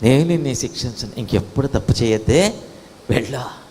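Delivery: low voice, softening to the end; gentle forgiveness. Acted. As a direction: release someone kindly. Jesus forgiving the woman.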